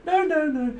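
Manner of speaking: hammed-up turning someone down, climbing in pitch; mock refusal. Tarot reader mimicking someone refusing, rising high. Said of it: "an energy of turning people down".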